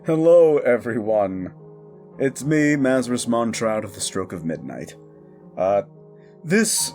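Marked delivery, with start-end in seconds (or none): none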